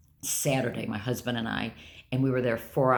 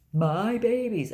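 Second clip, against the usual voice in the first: higher, slow, whiny mock sob; imitating him crying over the dogs; 9 semitones higher, slower than usual. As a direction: mimic his whimper, slowly. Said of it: "he starts crying about the dogs".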